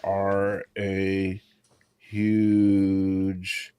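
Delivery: drawn out word by word while typing; slow typing-aloud. Real, not acted. Texting the co-host's daughter aloud, slowly drawn out. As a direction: stretch each word as you type it.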